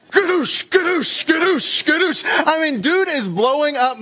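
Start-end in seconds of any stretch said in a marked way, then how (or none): 0.1-2.2 frantic imitated air pump; pump noise. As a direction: push each gadoosh out hard like pumping. Doctor pumping more fluid.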